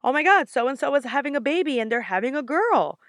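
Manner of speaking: thrilled announcement of the dream; excited. Retold or imitated. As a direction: blurt out the big news. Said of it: "I was like".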